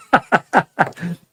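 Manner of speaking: a short, high burst of laughter. Real, not acted. Laugh alone on line. (mataas na maikling tawa)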